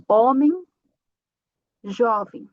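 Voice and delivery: homem and jovem ending in a nasal em. Shown as a demonstration. Teacher showing students the nasal final em. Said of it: "o som vai ser em".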